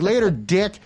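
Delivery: retold jab at the furious venue owner; mocking taunt. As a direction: toss it off like a smug goodbye.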